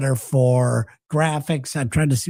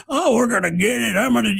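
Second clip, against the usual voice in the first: lightly hammed-up, higher-pitched tough-guy bluster; mock tough guy; 7 semitones higher than usual. Podcaster mocking a leader's approach to Greenland, mock tough-guy voice. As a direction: imitate a tough guy throwing his weight around. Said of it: "acting like a tough guy".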